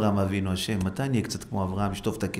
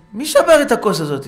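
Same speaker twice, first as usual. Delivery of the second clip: high, loud shouted demand; a father yelling at the kids; angry demand; 10 semitones higher, 11 dB louder than usual. Acted. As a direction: bark out who broke it. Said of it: "אחד שאל".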